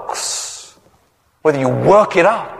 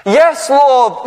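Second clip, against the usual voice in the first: high, louder gasping reply; gasped; 10 semitones higher, 3 dB louder than usual. Retold or imitated. Desperate man answers the Lord in a high gasp. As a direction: gasp it out in panic. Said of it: "the man gasped".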